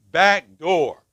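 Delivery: loud, clipped robotic alarm voice; alarm announcement. Retold or imitated. Man at a counseling group voicing the burglar alarm, mock robotic announcement. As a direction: imitate the house alarm's flat announcement. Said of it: "we get this announcement".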